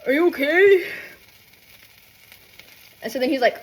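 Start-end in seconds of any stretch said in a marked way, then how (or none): 0.1-0.9 high, louder put-on check-in; exaggerated concern. Dreamer walking over to partner, high exaggerated concern. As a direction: pitch it up in mock concern.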